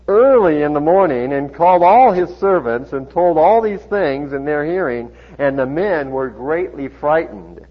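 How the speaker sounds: loud, dramatic Bible reading; animated pulpit reading; scripture reading. A narrator's reading. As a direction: project the story, lifting pitch for the king's reproach. Reads scripture aloud to congregation.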